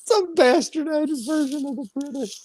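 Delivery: high, squeezed through laughter; laughing repeat. Real, not acted. Laughing repeat of the other's line.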